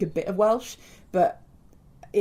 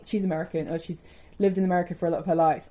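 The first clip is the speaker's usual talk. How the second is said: normal talking voice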